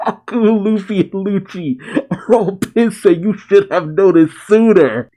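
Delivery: high voice bubbling with real laughter; cracking up mid-sentence; laughing while talking. Real, not acted. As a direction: struggle to talk through the giggles. Cracking up at characters' anger.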